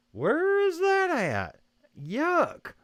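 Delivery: high-pitched, drawn-out question of dismay; appalled disbelief. Real, not acted. Streamer hearing of a viewer's snow, appalled disbelief. Reacting to reported teens and snow.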